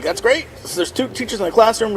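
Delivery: high-pitched naive enthusiasm; retold excitement. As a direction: act out being thrilled by good news. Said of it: "We were really excited".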